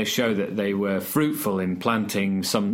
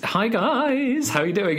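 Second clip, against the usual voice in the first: high, bouncy sing-song greeting; 11 semitones higher than usual. Retold or imitated. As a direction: chirp it like an upbeat student. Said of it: "everything's a little bit sing-song".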